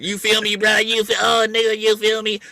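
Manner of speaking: high, put-on street-talk voice. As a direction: mimic his affected slang to tease him. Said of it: "you got that voice".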